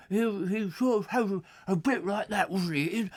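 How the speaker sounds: put-on nature presenter, mangling the words; David Bellamy impression. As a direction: enthuse over a tiny plant in a hammed-up presenter voice. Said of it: "I can't do David Bellamy".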